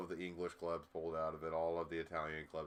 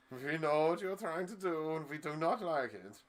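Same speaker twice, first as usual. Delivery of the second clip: put-on higher, louder German-accented warning; imitating a disapproving German club; mock German; 10 semitones higher, 6 dB louder than usual. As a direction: play a stern German official, hammed-up. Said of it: "all the German teams were like".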